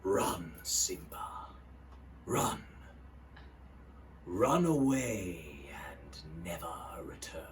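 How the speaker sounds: slow, low whispered threat; villain whisper. Retold or imitated. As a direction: breathe the banishment slowly. Scar whisper impression.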